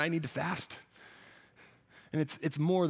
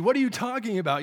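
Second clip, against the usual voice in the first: incredulous disciples; 6 semitones higher, 5 dB louder than usual. Retold or imitated. Disciples scoffing at Jesus.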